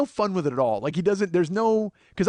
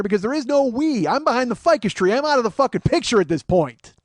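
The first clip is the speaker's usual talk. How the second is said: loud, higher-pitched comic rant; exasperated correction; 4 semitones higher, 5 dB louder than usual. Acted. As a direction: argue it emphatically, fed up. Exasperated correction of his friend.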